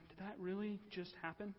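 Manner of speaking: hushed, stunned question; stunned disbelief. Acted. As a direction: say it softly, as if struggling to take it in. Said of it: "at least a couple seconds of shock".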